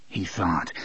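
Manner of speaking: usual speaking voice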